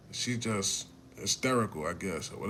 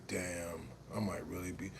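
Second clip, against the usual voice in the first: hushed dread; 5 semitones lower, 11 dB quieter than usual. Retold or imitated. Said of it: "realizing back broken".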